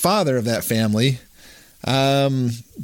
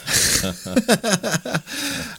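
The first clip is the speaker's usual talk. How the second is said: high laugh; 11 semitones higher, 3 dB quieter than usual. Real, not acted. Laughs at Jerry Hartley coincidence.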